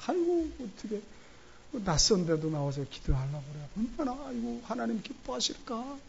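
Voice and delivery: elders praising. Retold or imitated. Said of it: "중진들이 칭찬합니다".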